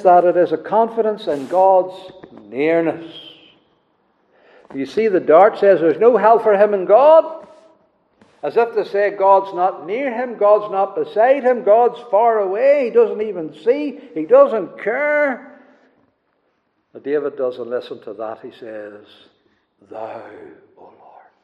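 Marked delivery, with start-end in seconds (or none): 4.7-15.6 preaching higher and louder as it builds; mounting pulpit cadence; rising preaching. Acted. Building sermon climax. 19.9-21.3 soft, hushed Thou, O Lord, with "Lord" fading to near a whisper; quiet first reading of "Thou, O Lord". Shown as a demonstration. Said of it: "that's an emphatic pronoun".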